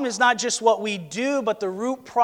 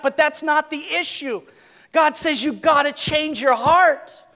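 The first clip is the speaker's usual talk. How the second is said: raised, driving preacher's voice; impassioned preaching; 5 semitones higher than usual. Acted. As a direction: press the point home from the pulpit.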